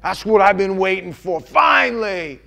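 God rejoicing over the broken man, loud and glad; joyful welcome. Retold or imitated. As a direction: celebrate his return. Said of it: "what he said to me".

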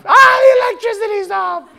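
high, loud mock-panic scream; panicking wife. Wife phones about the power going out, hammed-up panic. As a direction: shriek it like a hammed-up panic call. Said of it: "phone call panicking from my wife".

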